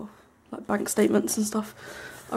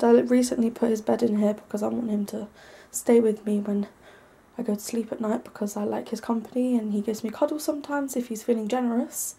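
ordinary delivery

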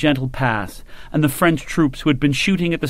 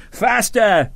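high shouted command; Sharp yelling at the firing line; 7 semitones higher than usual. A narrator's reading. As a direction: drive the men on. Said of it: "Sharp shouted".

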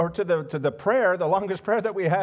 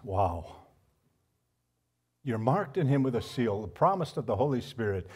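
ordinary talk

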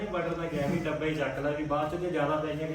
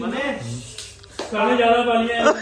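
hearty loud trucker banter; 5 semitones higher, 11 dB louder than usual. Real, not acted. Laughing shout about too much chilli.

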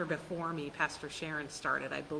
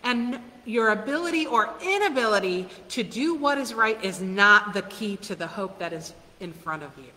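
ordinary talk, nothing marked

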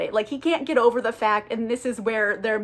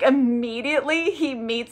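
ordinary talk, nothing marked

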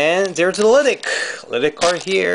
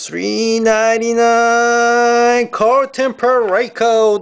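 high, drawn-out hype announcer call; excited announcer; 7 semitones higher, much slower than usual. Real, not acted. Card breaker revealing a numbered rookie hit, excited announcer. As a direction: stretch the words out in a high announcer voice.

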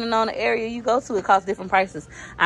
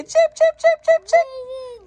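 put-on much higher, slow babyish cheap cheap cheap; imitating baby; 4 dB louder, slower than usual. Mom imitating her baby slowly and much higher. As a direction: stretch out cheap cheap cheap like a toddler. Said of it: "Baby say".

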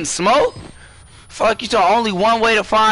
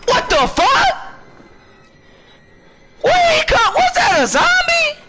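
startled shout. Real, not acted. Jump scare in horror game.

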